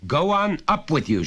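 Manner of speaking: high, loud order; shouting. Acted. Father shouting at his son to go up, loud. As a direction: shout him up the stairs. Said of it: "shouted his father".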